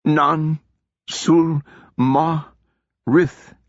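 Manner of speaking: dragging each word up in pain; strained spell syllables; struggling words. Acted. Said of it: "each word only after a fight".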